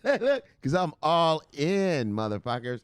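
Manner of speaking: high, drawn-out boast; imitated defiant woman. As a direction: her swaggering call-out, voice dropping at the end. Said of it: "'Cause she said".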